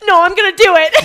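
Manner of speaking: high, loud insistence breaking into laughter; defiant yell. Retold or imitated. Athlete overruling the judge about extra reps, defiant yell. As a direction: shout it stubbornly, half laughing.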